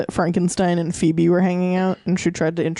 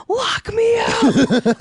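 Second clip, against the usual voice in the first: high, drawn-out mock scream; hammed-up desperate movie yell; imitated scream; 3 dB louder, much slower than usual. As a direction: scream it out long and high, as an impression. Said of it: "just fires it into the air and screams".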